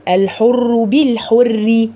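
بالحر with a thin light ر under kasra, slow. Shown as a demonstration. Teacher contrasting the light ر of بالحر. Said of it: "اكسري الراء كويس".

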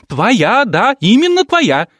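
high, loud hysterical insisting; hysterical shriek. Acted. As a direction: shriek именно hard.

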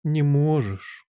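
slow, drawn-out sympathy; pitying. A narrator's reading. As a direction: stretch it out with pity. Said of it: "повторила королева с жалостью". (протяжно, с жалостью)

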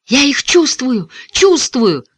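high, drawn-out exclamation; thrilled cry. A narrator's reading. Davy Ray feeling his wings come free, thrilled high cry. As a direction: cry out with dawning joy.

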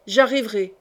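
plain reading voice

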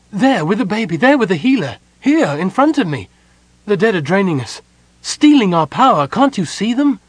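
high, loud, desperate pointing-out; frantic crying out. A narrator's reading. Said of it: "Goldmoon cried".

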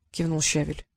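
plain reading voice

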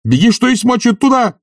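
very high urgent yell; frantic shouted order; shouting after her. A narrator's reading. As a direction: call to someone running away. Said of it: "кричал он ей вслед".

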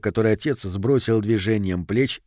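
ordinary delivery

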